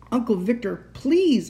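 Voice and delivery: high plea peaking on the last word; crying out. A narrator's reading. Trapped child begs the uncle, crying out. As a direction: cry out, begging. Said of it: "I cried".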